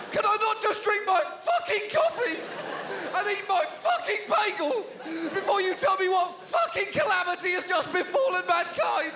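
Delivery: high, shouted morning rage; angry husband rant. Retold or imitated. Husband snapping at his wife over breakfast, furious shout. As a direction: rant like he's furious before coffee.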